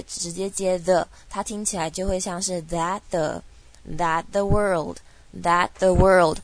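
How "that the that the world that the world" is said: "that the world" slowly, no t in "that"; emphatic "that the world" in three slow syllables; dropped T. Shown as a demonstration. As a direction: go straight from "that" into "the" in "that the world". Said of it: "that的T省略，直接接the".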